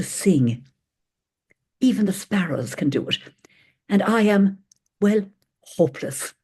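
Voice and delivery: heartfelt reading of a worried poem. Acted. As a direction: read the poem with feeling. Reads Mary Oliver poem.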